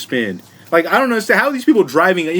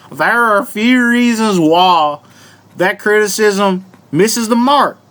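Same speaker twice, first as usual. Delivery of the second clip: slow, stretched Southern drawl; real Southern accent; 2 semitones higher, slower than usual. Acted. Host reads a Cracker Barrel article, hammed-up Southern accent. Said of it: "read this whole article in this voice".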